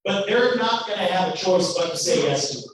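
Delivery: ordinary talk, nothing marked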